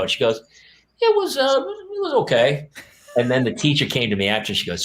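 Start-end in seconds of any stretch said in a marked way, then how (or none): 1.0-2.7 put-on very high, slow little-kid voice; evasive child. Child in the car after a failed rehearsal, high hesitant evasion. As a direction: imitate a child hedging, high and drawn out.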